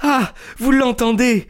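high, triumphant challenge; a young woman's high, defiant exclamation; defiant cry. A narrator's reading. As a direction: throw it at Lestrade in defiance. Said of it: "d'un air de défi".

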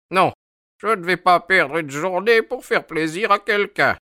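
put-on high, querulous old man's voice; indignant high-pitched elder; stubborn old sailor. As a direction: refuse crossly like an aged mariner. Old sailor refuses to wait.

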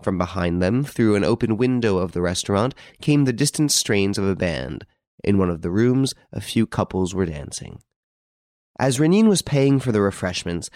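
ordinary delivery, nothing marked

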